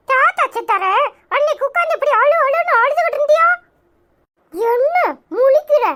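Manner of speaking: usual speaking voice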